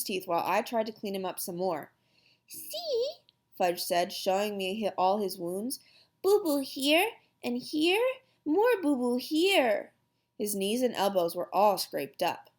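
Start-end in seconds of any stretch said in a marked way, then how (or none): 2.5-3.1 put-on high, soft little-boy voice; hurt toddler. A narrator's reading. Toddler shows his wounds. 6.2-9.9 put-on high, slow baby talk; hurt toddler. A narrator's reading. Toddler shows his wounds.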